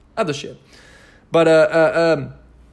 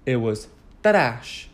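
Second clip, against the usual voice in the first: slow mock-Italian trash as ta-RASH; mock foreign accent; 4 semitones lower, slower than usual. Shown as a demonstration. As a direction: draw out trash in a put-on European accent. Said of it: "Is that Italian?".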